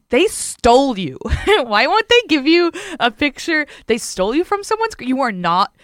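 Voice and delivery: high, loud, half-joking accusation; mock outrage. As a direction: drag out the accusation in exaggerated disbelief. Half-joking outburst retold to boyfriend.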